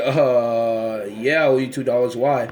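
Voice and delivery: low, hesitant admission; cautiously owning up, voice dropped; wary reply. Retold or imitated. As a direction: answer low after a drawn-out uh. Retold wary reply to a demand.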